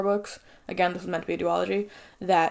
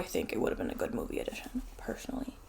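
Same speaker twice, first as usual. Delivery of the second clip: whisper; 3 semitones lower, 13 dB quieter than usual. Real, not acted. Hushed aside after rant.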